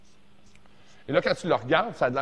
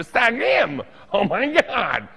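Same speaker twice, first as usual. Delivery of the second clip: excited; 8 semitones higher, 7 dB louder, much slower than usual. Retold or imitated. Excited reaction to rhyme in retold quote.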